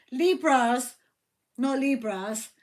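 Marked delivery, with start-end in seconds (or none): none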